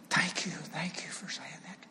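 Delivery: imitating a crying woman's whispered thanks; soft, choked whisper, as if through tears; tearful thanks. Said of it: "she's just crying".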